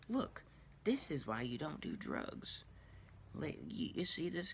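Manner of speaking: put-on quiet, half-whispered warning to a child; mock hushed "don't do drugs" lesson. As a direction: lean down and warn the little girl under your breath. Dad warning his granddaughter.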